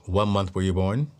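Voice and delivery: low, hushed-down fortune-teller voice; low put-on psychic questioning; psychic's odd voice. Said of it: "Why are you talking like that?".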